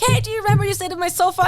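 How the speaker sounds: high, fast pitch for a favor; sweet-talking request. Retold or imitated. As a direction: rattle it off high, buttering up an old acquaintance. Acts wheedling call to old guest.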